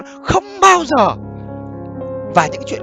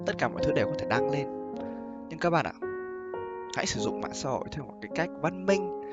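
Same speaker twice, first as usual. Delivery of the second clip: pillow talk; 10 semitones lower, 17 dB quieter than usual. Real, not acted. Soft closing advice.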